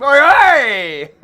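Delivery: loud shout to grab attention; Oi, hey yelled loudly; calling out. Real, not acted. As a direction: bark it across the court. Calling out on the court.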